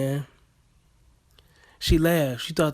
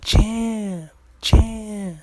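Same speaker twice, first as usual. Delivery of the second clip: higher, gentle repeated champ; mock tender comfort, a touch higher; soothing coach voice; 6 semitones higher, 4 dB louder, much slower than usual. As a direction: console him like a hurt kid. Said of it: "that's what I was thinking".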